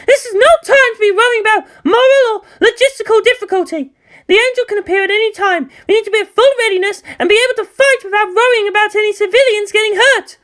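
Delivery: high, loud, fast heated argument; hostile insistence. Acted. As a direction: argue against him hotly. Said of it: "Misato's antipathy returned".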